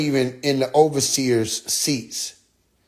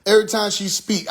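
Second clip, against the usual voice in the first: ordinary talk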